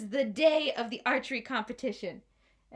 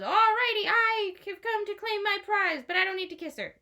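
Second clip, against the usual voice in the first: high, loud chirpy announcement; bright stranger; 10 semitones higher, 5 dB louder than usual. A narrator's reading. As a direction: announce it cheerfully, pitched up. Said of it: "he goes".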